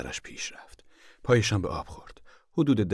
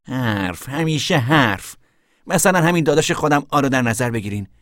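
put-on scornful young man, higher and louder; Tom's voice; 9 semitones higher, 10 dB louder than usual. A narrator's reading. Tom complaining about his brother Al, higher and louder. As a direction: grumble it, pitched up.

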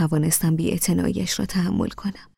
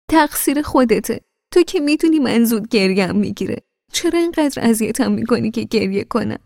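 tearful complaint; 5 semitones higher, 5 dB louder than usual. A narrator's reading. Wife complaining after crying.

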